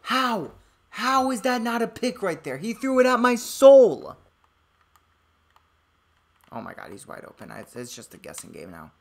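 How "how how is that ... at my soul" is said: loud, disbelieving, laughing yells; outraged shouting. Real, not acted. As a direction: yell in disbelief at the screen. Interception thrown against him.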